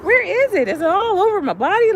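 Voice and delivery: high, loud frantic questions; hammed-up alarm, voice way up; mock panic. Searching for the web on the body, panicked.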